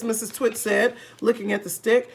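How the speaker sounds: ordinary delivery, nothing marked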